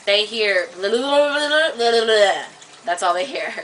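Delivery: ordinary talk, nothing marked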